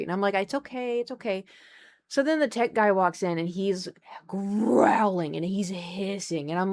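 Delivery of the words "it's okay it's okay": soothing. Retold or imitated. Soothing an angry cat.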